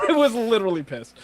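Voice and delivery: laughing through the words; high, loud laughing echo. Real, not acted. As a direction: giggle as you repeat the joke. Laughing at friend's pun.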